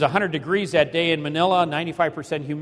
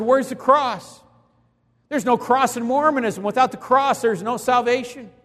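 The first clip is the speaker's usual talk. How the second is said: preaching the cross; 7 semitones higher than usual. Retold or imitated. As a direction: proclaim it up and out over the crowd. Preaching at Mormons.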